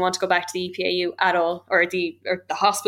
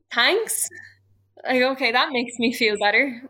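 higher-pitched, sarcastic thanks; 4 semitones higher than usual. Retold or imitated. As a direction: sound unconvinced by a hollow apology. Bitter retort to doctor's sympathy.

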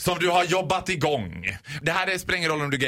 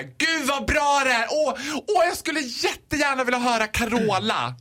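gushing happy fan; 6 semitones higher than usual. Acted. Happy guest requesting a song from the DJ, gushing. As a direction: gush at the DJ like a thrilled fan. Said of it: "om du glatt kommer fram".

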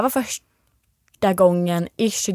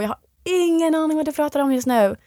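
high, exasperated voice; exasperated denial; 7 semitones higher than usual. Retold or imitated. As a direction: insist high and baffled. Retold denial in fight. (högt och uppgivet)

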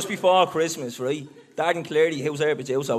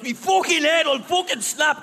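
imitating a fuming friend; 10 semitones higher than usual. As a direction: play the friend raging after banging his head on the window. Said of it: "and he was fuming".

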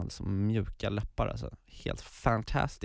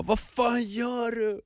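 high, slow mock outrage; 13 semitones higher, slower than usual. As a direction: play her shocked and angry. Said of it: "hon bara". (hög, långsam spelad ilska)

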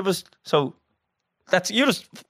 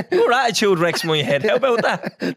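high, laughing retort; laughing comeback; 6 semitones higher than usual. Real, not acted. Friend fires back a playful jab, laughing. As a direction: toss it back through laughter.

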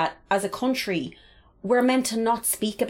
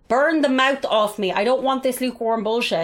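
exaggerated demand; 4 semitones higher than usual. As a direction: make an over-the-top demand, pitched up. Acted demand for hotter drink.

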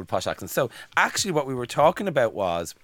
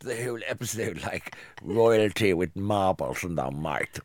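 posh marbles voice; 4 semitones lower than usual. Acted.

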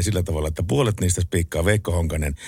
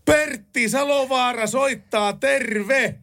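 much higher, very slow bellow; bellowing into phone; much slower than usual. Retold or imitated. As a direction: shout your name down the phone. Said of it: "mä karjusin pää täysin puhelimen". (karjuen puhelimeen)

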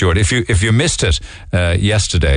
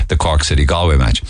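ordinary talk

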